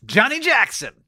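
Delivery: high-pitched mock American name call; exaggerated American accent. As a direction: echo it back in a hammed-up American accent. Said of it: "say in an American accent".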